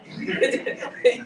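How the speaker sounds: loud, high, breathy real laugh; burst of laughter. Real, not acted. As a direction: let the laugh spill out. Laughing while retelling hospital.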